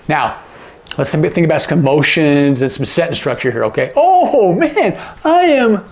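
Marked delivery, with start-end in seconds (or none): 3.9-5.8 much higher exclamation, stretched; high, drawn-out envious oh man. Acted. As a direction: gush with envy. Acting out jealousy example.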